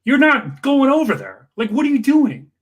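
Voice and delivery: exasperated mock scolding; incredulous retold scold. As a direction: push back hard in disbelief. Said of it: "I'm like".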